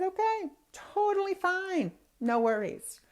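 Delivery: sweetly over-reassure the mechanic; high drawn-out okay to the mechanic, forced cheerful; sing-song reassurance. Retold or imitated. Same reply, sweetly exaggerated.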